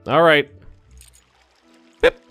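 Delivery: ordinary talk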